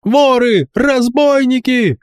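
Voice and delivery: high, slow bawled alarm; yelling. A narrator's reading. Boy pinned on the floor, bawling for help. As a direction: bawl it out while pinned. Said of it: "орал по-прежнему Рокамболь".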